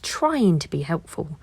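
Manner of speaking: plain reading voice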